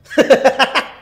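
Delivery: big hearty burst of laughter; a full belly laugh; 1 loud laugh. Shown as a demonstration. Demonstrates laughter yoga.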